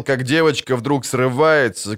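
ordinary delivery, nothing marked

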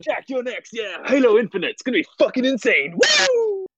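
high, shouting hype-man; overhyped announcer. Pretend. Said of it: "Oh god, that peak".

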